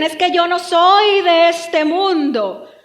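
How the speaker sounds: slow, exaggerated pious proclamation; mock piety. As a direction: parody a sanctimonious believer. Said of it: "Y muchos dicen".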